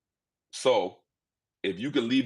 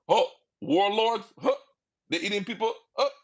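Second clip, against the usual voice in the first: mocking shock; 9 semitones higher than usual. Friend googling Liberia, mocking.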